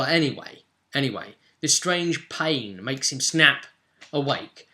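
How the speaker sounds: ordinary talk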